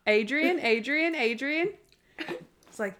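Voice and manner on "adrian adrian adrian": high, rising mock call; imitated searching call, pitched up; questioning name call. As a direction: call the name over and over, lifting each one at the end. Imitating someone calling out a name.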